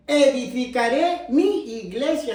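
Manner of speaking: slow quote with "mi" drawn out; emphatic scripture quote. Shown as a demonstration. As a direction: stretch "mi" and let "iglesia" fall.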